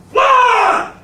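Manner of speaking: loud, high shouted order; yelled command. Retold or imitated. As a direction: yell it to force him up. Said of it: "he just yelled at him".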